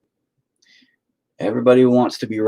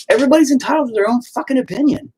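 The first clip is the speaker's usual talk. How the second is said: high, louder, fast rant; 4 dB louder, faster than usual. Real, not acted. Man venting to his viewers about opinions, in a high fast rant. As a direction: hammer the point home at the peak of the rant.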